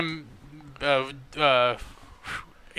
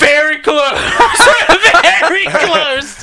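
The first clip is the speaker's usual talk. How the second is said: laughing; 9 semitones higher, 5 dB louder, slower than usual. Real, not acted. Laughing at close guess.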